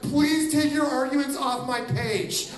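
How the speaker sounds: a poster shooing off arguers; mock exasperated plea. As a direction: plead with the crowd in mock annoyance. Poster pleading.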